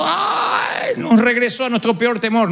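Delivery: a mock lament that cracks up high at the start; mock wailing. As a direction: parody a crying churchgoer. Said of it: "se vayan todos llorando".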